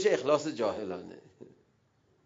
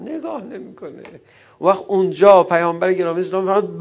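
normal talking voice

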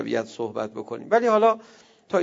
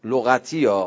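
ordinary talk, nothing marked

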